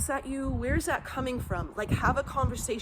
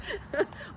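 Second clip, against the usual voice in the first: a quick high-pitched giggle; 11 semitones higher, 4 dB quieter than usual. Shown as a demonstration. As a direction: let a little laugh slip out. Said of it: "you could guffaw".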